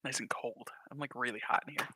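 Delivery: soft breathy whisper after a drink. Real, not acted. Speaker refreshed after a cold drink, whispering. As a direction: whisper the relief. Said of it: "I'm so thirsty".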